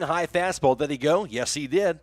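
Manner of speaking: ordinary delivery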